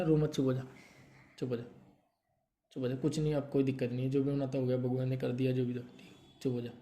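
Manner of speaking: very soft, low hush to someone crying; gently calm a crying person, almost under the breath; soft consoling. Real, not acted. (बहुत धीमे, प्यार से चुप कराते हुए)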